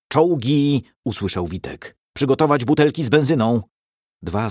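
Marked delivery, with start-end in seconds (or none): 0.1-0.8 loud, high urgent cry of alarm; warning shout. Acted. Insurgent warns the barricade of tanks, shouting loudly. 2.1-3.6 fast, loud, high barked command; shouted order. Acted. Commander orders fighters to ready petrol bombs, shouting fast.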